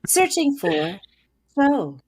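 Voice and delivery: flat machine voice; robot voice. Acted. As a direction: speak like a voice assistant. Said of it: "I really like your robot voice".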